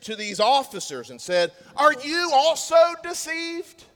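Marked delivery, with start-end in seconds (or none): 1.8-3.8 higher, slow, louder scornful question; scornful Pharisee. Acted. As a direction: sneer it down at the officers. Pharisees scolding officers.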